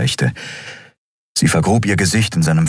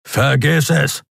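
raised, hard refusal; brusque shouted dismissal; gruff refusal; 7 semitones higher, slower than usual. A narrator's reading. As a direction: shut the plea down. Refuses her screamed plea.

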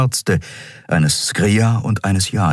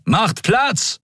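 shouted order. A narrator's reading. Medics clearing path.

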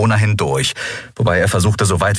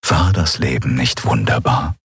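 low, self-satisfied cat purr; low feline purr; 6 semitones lower than usual. A narrator's reading. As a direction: purr it contentedly, deep in the register. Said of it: "schnurrte Chasu".

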